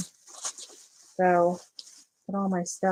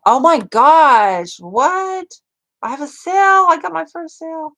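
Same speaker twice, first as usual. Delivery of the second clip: high, loud burst of real joy; first sale, and she can hardly believe it; thrilled squeal; 10 semitones higher, 12 dB louder than usual. Real, not acted. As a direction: squeal it out like you just got great news. Finds her first Etsy sale.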